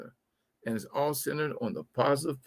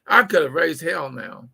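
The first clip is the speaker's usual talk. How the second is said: usual speaking voice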